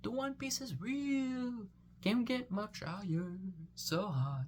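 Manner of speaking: high, slow airy meme quote; a hint of a dreamy stoner. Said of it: "that one meme".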